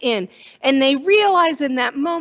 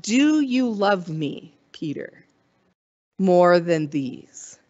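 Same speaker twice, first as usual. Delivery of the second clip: tender question; 6 semitones lower, slower than usual. Retold or imitated. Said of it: "And Jesus asks".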